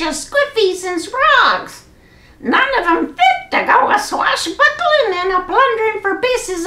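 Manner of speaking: high squawking pirate parrot. Acted. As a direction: chatter like a cheeky parrot.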